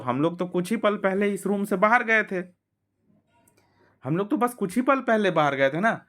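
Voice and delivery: ऊँची आवाज़ में हल्का ताना; Susan scoffing. Acted. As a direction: brush off the little one's story. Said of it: "said Susan".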